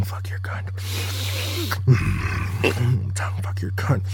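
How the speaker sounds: breathy unvoiced whisper, close to the ear. Whispering filthy promises to her partner. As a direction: lean in and whisper it. Whispered erotic dirty talk.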